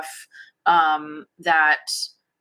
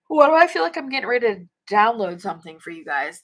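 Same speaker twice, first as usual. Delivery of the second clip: ordinary talk, nothing marked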